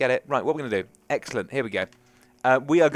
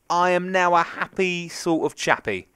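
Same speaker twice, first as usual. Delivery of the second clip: singsong rhyme; 6 semitones higher, slower than usual. Pretend. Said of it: "I rhymed for no apparent reason".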